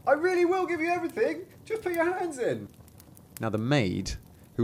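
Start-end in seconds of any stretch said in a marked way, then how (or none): 0.1-2.7 very high squeaky cry, not truly loud; tiny voice piping at full stretch. Acted. Thumbling answers the thieves, crying out in a high squeak. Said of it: "cried as loudly as he could".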